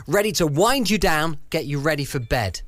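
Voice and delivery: ordinary talk, nothing marked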